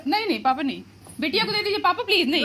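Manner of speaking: high, loud insistent pleading; pleading refusal. Real, not acted. Daughter refuses money from father, high loud pleading. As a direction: beg him to keep his money.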